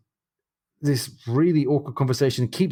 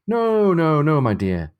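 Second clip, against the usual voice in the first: cried out; 6 semitones higher, 4 dB louder, slower than usual. Acted. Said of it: "cried Stephen".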